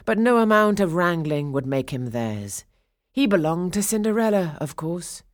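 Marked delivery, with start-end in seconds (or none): none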